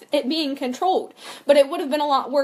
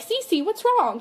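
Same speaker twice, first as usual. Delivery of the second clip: put-on higher, small child voice; little boy; 8 semitones higher, slower than usual. Babysat boy asking Cece what's wrong, higher child voice. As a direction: ask it like a worried toddler.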